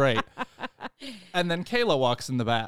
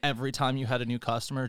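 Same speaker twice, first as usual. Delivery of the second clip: ordinary talk, nothing marked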